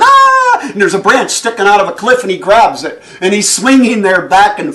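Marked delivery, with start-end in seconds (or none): 0.0-0.6 a sudden high scream while dropping through the air; falling scream. Retold or imitated. Man blown off the canyon rim, loud high scream. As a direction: scream out as the wind throws him off the edge. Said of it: "he's yelling".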